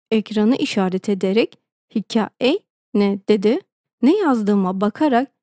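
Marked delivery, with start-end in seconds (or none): 1.9-3.2 slow question with hikaye as hika-ey; hikaye misread as hika-ey. A narrator's reading. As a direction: puzzle out the typo hika-ey slowly. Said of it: "hikayeyi yanlış yazmıştım". (hikaye yerine "hikaey" diye soru)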